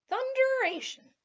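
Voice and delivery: high, loud burst of surprise; surprised exclamation. A narrator's reading. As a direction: blurt it out, astonished.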